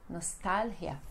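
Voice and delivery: "nostalgia" as nos-TAL-hia, stress on TAL; Spanish pronunciation. Shown as a demonstration. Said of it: "muito usada no espanhol".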